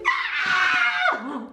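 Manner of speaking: very high, long squeal of joy; delighted squeal. Real, not acted. Wife thanking husband for the gift, joyful squeal. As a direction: let out a piercing happy squeal.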